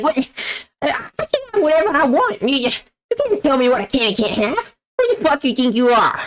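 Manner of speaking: mock-defiant grandpa in a bratty cartoon voice; Eric Cartman impression. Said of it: "I do, like, an Eric Cartman impression".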